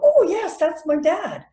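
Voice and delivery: high, loud, chirpy acted answer; eager reply. Pupil answering about a related Gibson, eager. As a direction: pitch it up like a pleased child.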